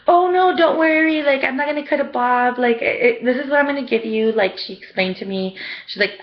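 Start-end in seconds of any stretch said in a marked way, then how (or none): 0.1-4.3 high, emphatic imitated reassurance; retold reassurance. Stylist to client afraid of a bob, high retold reassurance. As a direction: play a stylist brushing away fears, pitched high.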